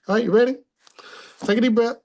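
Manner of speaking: high, loud and eager; rally the class to begin; upbeat call to start. Real, not acted. Teacher rallies class before exercise.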